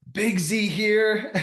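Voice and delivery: high, loud, slow put-on boss voice; imitating jacked Aussie boss. Boss presents new hire to the staff, hammed-up high voice. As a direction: ham up the big boss showing you off. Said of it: "and he's like".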